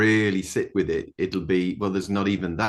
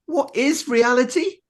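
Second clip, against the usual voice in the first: put-on much higher, wide-eyed little boy asking; child's voice; 4 dB louder than usual. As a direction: ask it like a small boy, very high and innocent. Grandson's big bedtime question.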